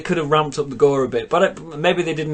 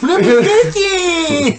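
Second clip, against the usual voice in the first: high, slow, laughing shout of the name; 6 dB louder, much slower than usual. Real, not acted. Friend shouting the catchphrase back while laughing, high and slow. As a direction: howl it out through laughter.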